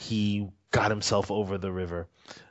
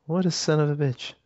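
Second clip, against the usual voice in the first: high, louder amused curse of a monk; mock exasperated monk; 8 semitones higher, 4 dB louder than usual. Monk reading the boastful poem, mock exasperated. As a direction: toss off the insult in a high, loud voice, half laughing. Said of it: "He goes".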